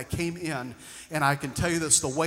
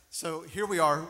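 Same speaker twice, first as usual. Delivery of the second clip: usual speaking voice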